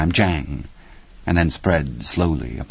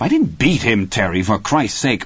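higher, indignant interruption; annoyed protest; 5 semitones higher than usual. A narrator's reading. Husband breaks in on his wife's accusation, indignant. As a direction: cut in, defending yourself hotly.

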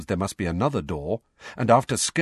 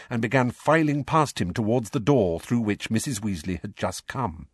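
plain reading voice

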